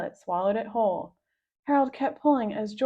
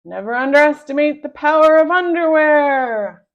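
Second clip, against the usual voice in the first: loud, higher superhero shout; heroic battle cry; 6 semitones higher, 14 dB louder than usual. A narrator's reading. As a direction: proclaim it like a caped hero. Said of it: "cried Captain Underpants".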